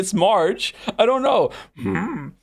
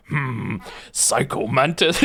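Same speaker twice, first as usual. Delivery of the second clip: Psycho Mantis voice; 6 semitones lower, slower than usual. Pretend. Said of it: "becoming something else there".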